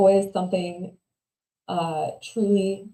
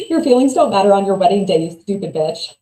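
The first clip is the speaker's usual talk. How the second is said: louder, jabbing imitation of the mother; mock mom lecturing her daughter, louder; 8 dB louder than usual. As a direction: play the mother scolding. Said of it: "My mom very calmly said".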